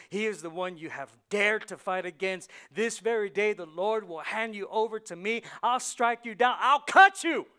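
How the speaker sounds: builds from firm to a full shout; rising defiance that ends in a yell; David's battle cry. Acted. David defying Goliath, building up.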